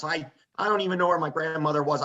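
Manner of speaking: normal talking voice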